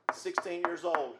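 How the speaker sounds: ordinary delivery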